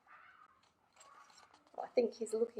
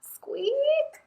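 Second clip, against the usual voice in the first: high whispered squeak; a tiny breathy squeak; 4 dB louder than usual. Acted. The mouse squeaking from the walls, whispered and high.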